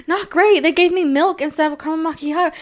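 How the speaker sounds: high, put-on sing-song whine; mock-cheery complaint. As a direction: complain in a too-high social voice. Retold complaint in greeting voice.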